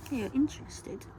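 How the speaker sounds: ordinary delivery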